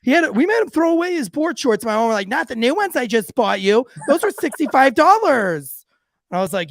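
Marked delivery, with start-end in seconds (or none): 0.0-1.8 high-pitched, animated telling on him; tattling friends. Retold or imitated. Friends tattle to his dad. 2.3-5.8 upset mom. Retold or imitated. Mom upset about costly shorts.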